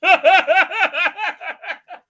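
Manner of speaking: high, loud cackle; rapid burst of giggling laughter; laughing. Real, not acted. Streamer laughing at his own story, high cackle.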